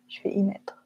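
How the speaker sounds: a soft whisper, testing the phrase under her breath; whispering a trial of the Savoie phrase; whispered to herself. Real, not acted. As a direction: mutter it to yourself while searching for the example.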